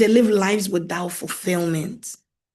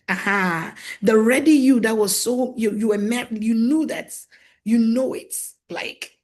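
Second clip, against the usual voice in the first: normal talking voice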